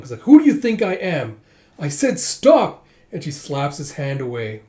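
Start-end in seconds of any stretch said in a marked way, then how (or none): 0.2-2.9 loud, high acted outrage; indignant shout. Girl scolds the drunk grabbing her, indignant shouting. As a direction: play an affronted lady, voice jumping up.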